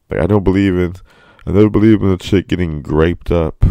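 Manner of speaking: high, loud mocking voice of a dickhead; mocking whiny victim. Ranting about people online, mock whiny voice. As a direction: sneer it out like the people you mock. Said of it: "motherfuckers who are just like".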